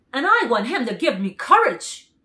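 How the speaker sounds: a timid lion pleading; lightly voiced Lion. A narrator's reading. Cowardly Lion telling the man his wish, loud plea.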